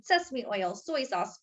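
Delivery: ordinary delivery, nothing marked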